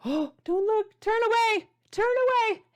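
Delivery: high, squealing mock panic at the breadsticks; mock horror. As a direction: shriek as if shielding kids' eyes, playfully.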